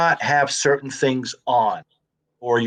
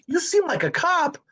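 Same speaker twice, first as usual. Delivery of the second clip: retold amazement; 14 semitones higher than usual. Co-star who mistook him for a cop, high retold amazement. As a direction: say it high, imitating disbelief.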